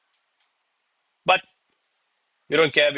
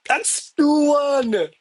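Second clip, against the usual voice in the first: excited; 11 semitones higher, 3 dB louder, much slower than usual. Real, not acted. Football score excitement.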